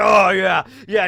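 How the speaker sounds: loud, high, gloating oh yeah; gleeful loud mock villain. Villain gorging on pills, loud and gloating. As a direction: bellow it like a villain gorging himself.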